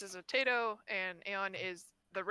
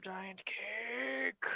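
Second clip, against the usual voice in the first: whisper. Pretend. Echoing the cake line.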